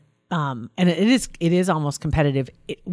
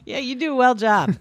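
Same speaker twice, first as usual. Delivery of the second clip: laughing; 7 semitones higher than usual. Real, not acted. Joking about bad grammar.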